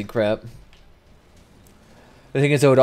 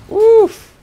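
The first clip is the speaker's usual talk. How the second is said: sharp high yelp of delight; surprised yelp; 11 dB louder than usual. Real, not acted. Streamer yelps at a big hit, high and loud. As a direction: yelp at the big damage number.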